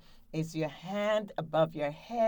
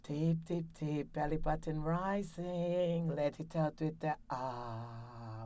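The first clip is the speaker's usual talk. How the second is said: breathing exercise; 7 dB quieter than usual. Shown as a demonstration. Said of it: "So let's go".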